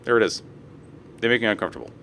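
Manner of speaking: usual speaking voice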